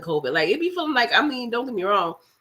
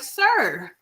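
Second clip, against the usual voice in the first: exasperated; 6 semitones higher, slower than usual. Retold or imitated. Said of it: "And I'm like".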